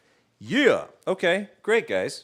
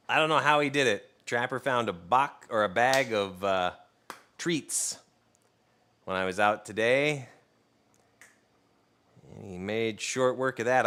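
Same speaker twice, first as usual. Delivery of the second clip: ordinary talk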